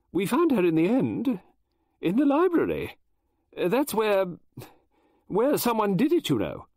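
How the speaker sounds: high, halting, broken by pauses; faltering voice. Acted. Mrs Oliver telling Poirot where the girl was found, faltering. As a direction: push through the words with hesitation. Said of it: "Her voice faltered".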